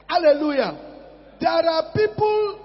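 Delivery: ordinary delivery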